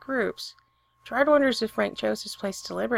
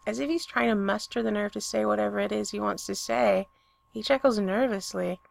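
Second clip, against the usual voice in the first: usual speaking voice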